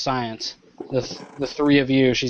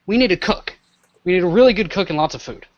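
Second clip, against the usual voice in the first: sudden realization; 4 semitones higher than usual. Acted. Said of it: "She's like".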